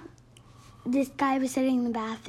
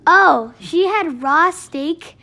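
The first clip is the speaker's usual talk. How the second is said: excited; 4 semitones higher, 12 dB louder than usual. Real, not acted. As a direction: blurt it out excitedly. Child excited telling scene.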